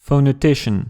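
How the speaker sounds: English "phonetician", stressed on TI; English word with English stress; English pronunciation. Shown as a demonstration. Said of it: "c'est phonéticien en anglais".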